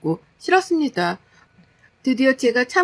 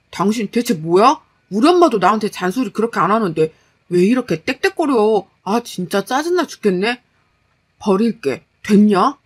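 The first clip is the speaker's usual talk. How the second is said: louder husband snapping back; irritated, resentful husband; 6 dB louder than usual. Acted. Husband objecting to wife's nagging, irritated. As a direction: fire back in annoyance, loud at the end.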